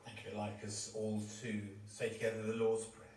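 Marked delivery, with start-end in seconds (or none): none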